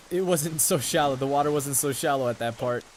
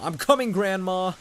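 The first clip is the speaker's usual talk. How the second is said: calling out; 6 semitones higher, 6 dB louder than usual. Acted. Calling out to grandma.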